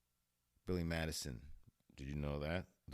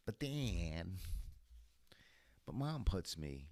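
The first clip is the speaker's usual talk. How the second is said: lightly voiced protesting kid, pitched up; mock whining son; 5 semitones higher, slower than usual. Son protesting to Dad, mock whiny boy. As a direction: whine back a little higher. Son whines back to scolding father.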